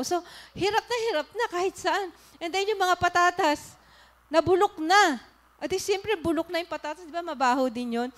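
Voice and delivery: preaching at full force. Acted. Preacher to the congregation about carrying grudges, loud fervent preaching. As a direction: hammer the lesson to the congregation. Preaches the rotten potato lesson.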